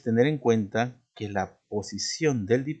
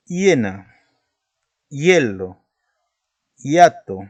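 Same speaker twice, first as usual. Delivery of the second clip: each word louder, with a pause after it; example words punched; 3 semitones higher, 7 dB louder, slower than usual. Shown as a demonstration. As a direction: present each example word as its own beat. (hiena, hielo, hiato, cada palabra marcada y más fuerte)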